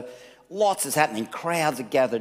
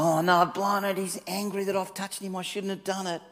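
lightly voiced fearful woman, softening as she goes; a touch of panic, trailing into hushed worry; imagined fear; 6 semitones higher than usual. Preacher voicing the frightened woman, mild fear. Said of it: "the fear I'd feel".

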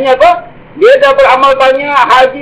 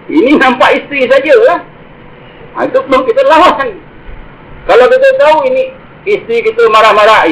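ordinary talk

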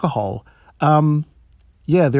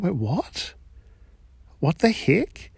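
high, softer, incredulous rising question; mock bafflement; 4 semitones higher, 4 dB quieter, slower than usual. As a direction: act out soft disbelief, pitch climbing at the end. Acts out shock at the cheap price.

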